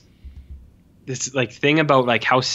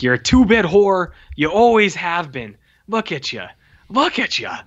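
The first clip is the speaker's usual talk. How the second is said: retold screaming cop; 6 semitones higher than usual. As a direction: berate her like an interrogator, short of a real scream. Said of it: "the cop is just fucking screaming at her".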